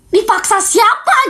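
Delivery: high and slow, stunned; shocked question. Retold or imitated. Hearing her friend was forced, a slow shocked high question. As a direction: ask it in disbelief. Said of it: "Gue kaget".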